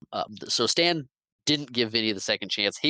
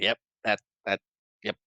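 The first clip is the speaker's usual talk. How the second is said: halting false starts; stuttering; faster than usual. Retold or imitated. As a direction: stammer and cut yourself off. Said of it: "stuttering and not saying the right things".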